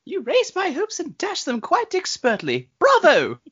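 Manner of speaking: put-on very high, slow little British boy voice; high childish British voice, cheering at the end; Tinny Tim impression. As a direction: squeak it out like an earnest little boy. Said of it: "Do your Tinny Tim impression".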